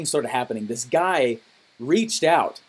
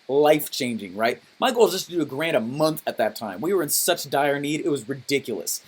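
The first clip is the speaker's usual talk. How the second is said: normal talking voice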